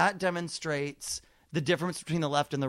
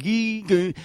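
meaningless leftover noises after speech; dopey imitation still droning with no words left; The Situation. Comic mimicking a reality star, stray droned syllables. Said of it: "keeps making sound even when he's done with words".

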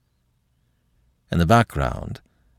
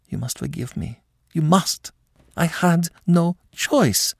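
a hint of a noblewoman begging, climbing in pitch; pleading mother; 3 semitones higher than usual. A narrator's reading. Madame begging her son to forgive her, pleading.

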